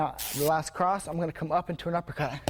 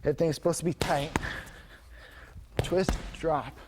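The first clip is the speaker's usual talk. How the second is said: ordinary delivery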